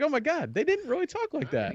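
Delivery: high, amazed exclamation; realizing the old movie voices were fake. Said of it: "I was like".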